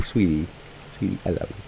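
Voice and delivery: tender hush. Real, not acted. Comforting his crying sweetie, soft and tender. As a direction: soothe her gently, barely voiced.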